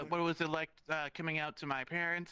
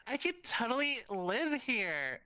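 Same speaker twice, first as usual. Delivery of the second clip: higher, dreamy voice for a friend admiring wallpaper; mock swooning over wallpaper, slowing down; imitating friend; 7 semitones higher, slower than usual.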